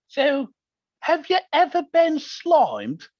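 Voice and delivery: high, slow put-on Australian accent. Guest imitating the Australian TV host in an Australian accent. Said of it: "And that's my Australian accent".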